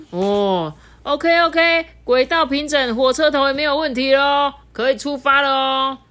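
high, loud, happy announcement; cheerful all clear. Acted. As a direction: call out that all is ready. Workers announce track fixed.

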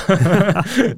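quick self-amused laugh; a brief real chuckle; short laugh. Real, not acted. Laughs at own joke.

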